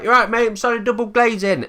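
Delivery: a cheery doorstep salesman angling in; pushy salesman. Retold or imitated. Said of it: "they're like".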